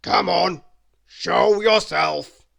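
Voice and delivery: menacing dragon command. Acted. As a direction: order the intruder out, big and slow. Dragon demands Tickle come out.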